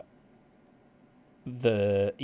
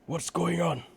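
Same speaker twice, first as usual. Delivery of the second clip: put-on high, frantic old wizard; panicked wizard voice; 8 semitones higher, 4 dB louder than usual. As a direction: babble in high-pitched alarm. Panicked wizards after a crash.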